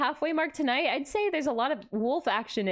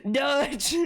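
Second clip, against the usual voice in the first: mock Southern man; 3 dB louder than usual. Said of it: "I can't do a deep Southern man voice".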